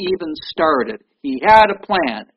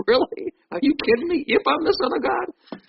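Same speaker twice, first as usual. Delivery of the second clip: high-pitched, disbelieving mock retort; incredulous mock; 10 semitones higher than usual. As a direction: act out an amused disbelief. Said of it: "he to go".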